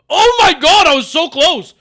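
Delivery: very loud, high outburst; high, explosive exclamation; excited shout. Real, not acted. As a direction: yell in real disbelief at a near miss. Near miss in guessing game.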